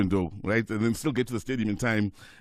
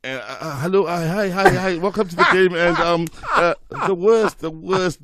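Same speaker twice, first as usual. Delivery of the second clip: louder, higher mock-nervous broadcast hello; flustered on-air greeting; 6 semitones higher, 7 dB louder than usual. Rookie commentator opens his first game, loud and flustered. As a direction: stumble into the mic like a panicked rookie.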